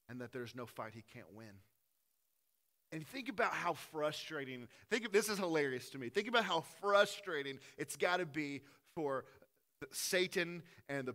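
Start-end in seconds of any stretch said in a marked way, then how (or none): none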